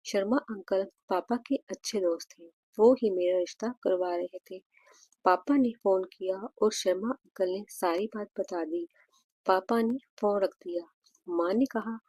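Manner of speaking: ordinary delivery, nothing marked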